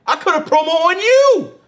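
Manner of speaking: high, loud exclamation; emphatic callout. Real, not acted. Calls out host with emphatic boast.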